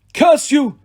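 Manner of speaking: high, loud cursing cry; cry of rage. Acted. The wounded doctor cries at the hidden archers, high and loud. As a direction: spit the curse out in pain. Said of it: "Thorne cried".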